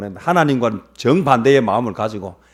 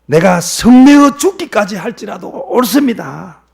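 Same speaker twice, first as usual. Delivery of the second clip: slow, high, loud self-justification; angry defiance; 10 semitones higher, 11 dB louder, slower than usual. Acted. Jonah answers God back about his anger, defiant. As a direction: insist on it loudly, dragging the words. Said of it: "이게 지금 어디라고 지금 이야기합니까".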